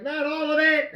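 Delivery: high-pitched cry of alarm to daughter; mock protest. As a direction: protest in playful panic.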